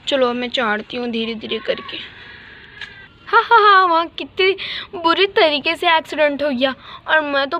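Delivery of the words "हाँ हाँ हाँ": loud mocking ha ha ha; wicked laugh; gloating laugh. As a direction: laugh cruelly at the crash. Villain laughing at accident.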